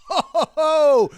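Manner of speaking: big hearty Santa laugh, high and loud; belly out a booming ho ho ho; jolly ho ho ho. Pretend.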